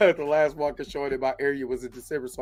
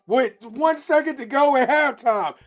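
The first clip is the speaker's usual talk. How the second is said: real yell, much higher and louder; excited sports shout; 11 semitones higher, 4 dB louder than usual. Real, not acted. Watching the game live, shouting in real excitement. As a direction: erupt at the buzzer-beater.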